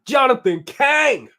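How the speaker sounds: loud, celebratory name call; big shout-out. Real, not acted. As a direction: announce the name loudly, like a hype man. Shout out to a contributor.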